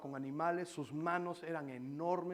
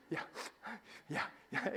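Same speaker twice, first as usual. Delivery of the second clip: fake whimpering; 2 semitones higher, 4 dB quieter than usual. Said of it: "Mis lagrimitas de cocodrilo".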